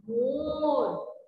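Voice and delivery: मोर said very slowly with a drawn-out ओ; teacher modelling मोर slowly; slow demo word. Shown as a demonstration. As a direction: draw out मोर slowly for the class. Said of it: "एक नया शब्द".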